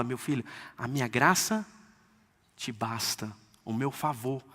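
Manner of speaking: put-on God telling his child that grace is enough, softly tender; tender father. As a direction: comfort the son quietly. God tenderly reassures his son.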